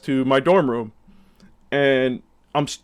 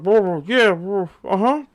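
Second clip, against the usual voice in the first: put-on high, slurred drunk mumble; drunk babbling; 7 semitones higher, 3 dB louder, slower than usual. Drunk guy answering the frat brother in slurred babble. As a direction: babble like a wasted guy barely making words. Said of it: "it wasn't really English, honestly".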